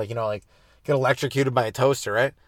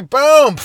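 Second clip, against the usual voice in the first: very high, loud shouted boom; mock punch boom; 13 semitones higher, 12 dB louder than usual. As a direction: shout the hit like a game punch. Acted punch sound.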